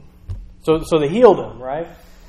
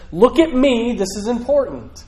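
attention-grabbing; 7 semitones higher than usual. Acted. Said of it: "It almost says".

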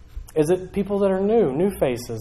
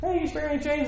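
high, soft little beggar voice; begging man; 9 semitones higher, 11 dB quieter than usual. Acted. Beggar asking Peter and John for change, meekly. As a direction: pipe up softly for a handout. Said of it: "And this man says".

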